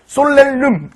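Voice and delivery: "솔렐름" drawn out as a misreading; wrong pronunciation. Shown as a demonstration. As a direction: say "솔렐름" slowly, with a false L in the middle. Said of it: "이거 아니고요".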